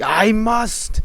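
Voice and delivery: high, loud insistent cry; loud, high-pitched outburst; yelling. Acted. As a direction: shout it out desperately. Zane yelling again as before.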